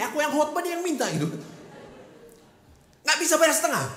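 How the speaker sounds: incredulous and worked up; indignant. Retold or imitated. Indignant retelling, raised voice.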